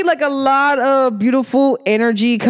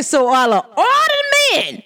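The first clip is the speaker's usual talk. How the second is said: retold retort; 8 semitones higher, slower than usual. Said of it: "I said".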